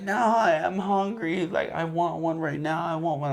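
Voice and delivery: put-on high, insistent kid's whine, first words dragged out; mock tantrum of a tearful little boy; imitated crying child. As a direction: act out a hungry child's tearful demand. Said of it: "crying, like, ugly crying".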